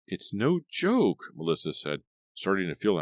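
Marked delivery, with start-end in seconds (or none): 0.1-1.3 higher, louder, slow and emphatic; a touch of anger; firm insistence. A narrator's reading. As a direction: plant each word, offended. Melissa insists, angry.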